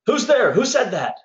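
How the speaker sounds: a thief jumping at a voice; startled call. Acted.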